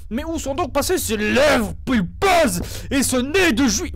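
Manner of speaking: normal talking voice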